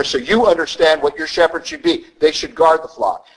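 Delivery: ordinary talk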